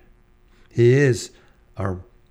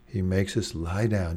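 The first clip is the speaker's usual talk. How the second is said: ordinary talk, nothing marked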